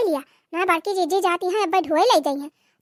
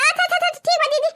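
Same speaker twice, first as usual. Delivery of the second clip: normal talking voice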